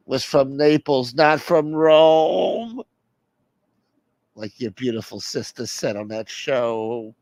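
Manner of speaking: slow, drawn-out mock whine; whiny caller, self-pitying. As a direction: drag out the complaint as if about to cry.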